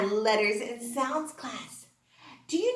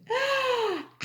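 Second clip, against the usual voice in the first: hammed-up gasp of delight; a big pretend gasp; mock surprised gasp; 10 semitones higher, 3 dB quieter than usual. Teacher gasping in mock surprise at Henrietta Hen.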